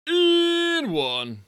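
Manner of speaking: very high, loud, drawn-out wail dropping on the number; over-the-top high announcer cry; game show announcer call. Acted. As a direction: belt out a darts game show prize number.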